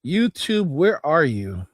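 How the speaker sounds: ordinary talk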